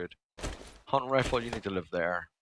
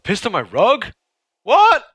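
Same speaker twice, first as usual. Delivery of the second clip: high, loud indignant cry; outraged disbelief; 12 semitones higher, 11 dB louder than usual. Real, not acted. Gamer hearing the dog peed on his rug, loud shocked outrage.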